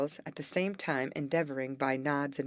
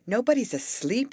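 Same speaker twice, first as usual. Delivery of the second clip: high, loud exclamation; Betty exasperated with tiptoeing friends, exclaiming loudly; 9 semitones higher, 6 dB louder than usual. A narrator's reading. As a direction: burst out that nobody is asleep. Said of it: "she exclaimed".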